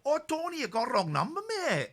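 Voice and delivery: high-pitched comic put-on voice; comic high-voiced impression. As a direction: mimic a wrong-number brush-off, pitched high. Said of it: "You're not doing a bad impression".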